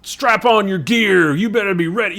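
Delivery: barking orders, high and loud; hammed-up aggressive command; Nick Fury. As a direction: yell it like a gruff drill commander. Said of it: "He's always yelling and barking orders".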